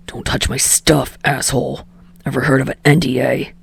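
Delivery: put-on low muttered boyfriend voice, speeding up at the end; low gruff Bakugo voice. A narrator's reading.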